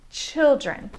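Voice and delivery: put-on first vowel of "Children" as in "chill"; short I. Shown as a demonstration. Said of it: "with a short I vowel sound".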